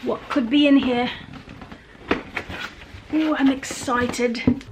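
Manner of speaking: usual speaking voice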